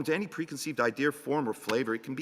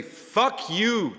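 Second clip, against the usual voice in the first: defiant curse; 8 semitones higher, 4 dB louder than usual. Acted. Advising to curse at the world.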